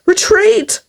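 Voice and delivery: high, sharp yell of retreat. A narrator's reading. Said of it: "yelled the wolf".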